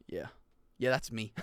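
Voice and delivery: ordinary talk, nothing marked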